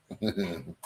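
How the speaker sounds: brief, soft laugh; quiet little chuckle to yourself; short chuckle. Real, not acted. Host amused by the Dean phenomenon, soft short chuckle.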